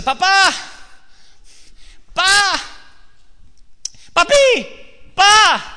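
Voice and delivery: high, loud desperate cry of "Papá"; child calling out. Acted. Bound boy on the altar cries out to his father, high and desperate. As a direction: call your father from the altar, frantic.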